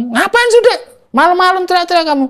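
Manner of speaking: high, fast scolding mother. Retold or imitated. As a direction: nag like a woken mom. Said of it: "Mama saya kebangun". (ibu yang terbangun menegur anaknya dengan nada tinggi)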